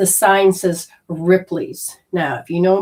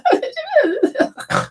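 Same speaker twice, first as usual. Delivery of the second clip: acted-out high-pitched squeal of laughter. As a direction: burst into a high, giddy squeal. Acts out reaction to better room.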